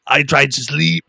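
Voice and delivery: slow, imitated heavy Russian accent; Russian teammate complaining he can't sleep, slow Russian accent. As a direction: drag it out like a Russian teammate woken up. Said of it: "in his big Russian accent".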